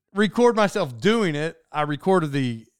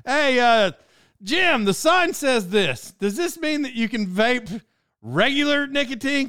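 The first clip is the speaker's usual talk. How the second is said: raised, higher voice calling across to Jim; retold yell to the boss, higher and louder; yelling back; 7 semitones higher, 3 dB louder than usual. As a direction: call the question back loud and high. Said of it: "That's what I yelled back".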